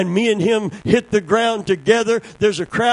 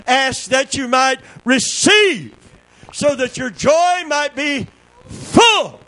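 much higher, louder, stretched preaching; full-force preaching; 11 semitones higher, 4 dB louder, slower than usual. Real, not acted. Preacher driving the point home to the congregation, shouting. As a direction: build to a climax.

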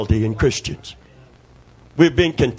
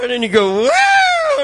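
very high loud whooping cry; joyful whoop; 3 dB louder than usual. Retold or imitated. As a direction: shout woo like a bounding dog. Imitating dog's joyful run.

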